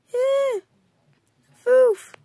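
sudden high yelp; high startled yelps; high squeak. Acted. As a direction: squeak it out in alarm. Character falls, squeaky cries.

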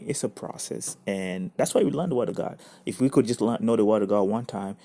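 usual speaking voice

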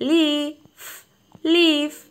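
ലീഫ് said clearly as leef, long ee; ലീഫ് drawn out, then clipped; teacher modelling leaf. Shown as a demonstration. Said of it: "ലീഫ് എന്നാണ് ഇലയെ നമ്മൾ പറയുന്നത്". (ലീഫ് എന്ന് വ്യക്തമായി ഉച്ചരിക്കുന്നു)

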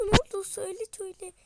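high, loud overjoyed; excited news. Acted. Father telling his family they got rich. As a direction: burst with the good news.

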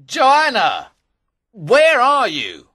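very high, loud drawn-out shout; acted furious searching yell; calling out. A narrator's reading. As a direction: bellow for someone missing. Sir Daniel calling again.

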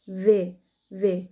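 slow, drawn-out heavy في; في stretched and thick. Shown as a demonstration. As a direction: lean into في, slowly. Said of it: "تقيل كده شوية في النطق".

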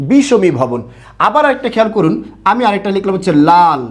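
normal talking voice

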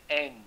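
"ang" said slowly as aynj; long-vowel ange. Shown as a demonstration. Teacher showing the spelling's sound, "ang" as aynj. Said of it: "念ang".

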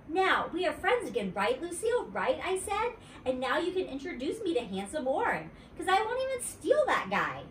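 put-on bright little-girl sweetness, pitched high; wheedling kid voice up high; high girlish Junie B. voice. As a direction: coax her back as a friend. Junie B. pressing Lucille.

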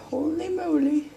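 amazed, loud and high; surprised exclamation. Real, not acted. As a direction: blurt it out in amazement. Reacts to record step count.

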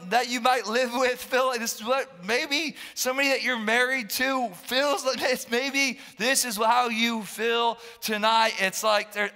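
usual speaking voice

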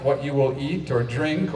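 ordinary delivery

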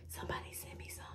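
whispered joking aside. Real, not acted. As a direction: breathe it as a cheeky secret.

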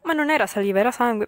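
ordinary talk

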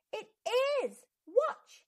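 high, loud burst of excitement; excited insistence. Acted. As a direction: show them, eager and bursting. Boy insists his pencil is magic.

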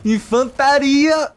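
high, slow, drawn-out announcement; announcer voice. Pretend. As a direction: call it out like a game announcer. Announcing infantry, put-on voice.

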